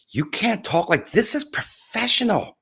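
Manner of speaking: high-pitched, slow scolding imitation; mocking upset critics. Imitating upset LinkedIn people after his swearing, mockingly. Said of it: "so upset at me".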